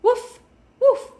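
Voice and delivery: high, loud yapping woof; squeaky loud puppy bark; dog barking. A narrator's reading. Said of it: "No dog said".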